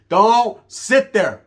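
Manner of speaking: slow, loud, drawn-out threat; angry warning. Retold or imitated. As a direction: lean on every word, menacing. Ordering newcomer away.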